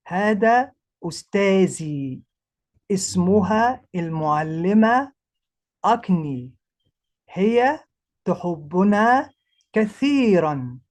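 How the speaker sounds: measured Arabic read-aloud; careful Arabic reading. Shown as a demonstration. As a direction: spell out each Arabic word for the class. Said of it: "that's exactly Arabic languages".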